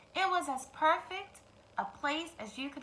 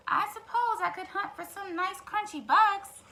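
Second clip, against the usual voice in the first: sighing hen; 7 semitones higher, 3 dB louder than usual. Acted. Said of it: "sighed Henny Penny".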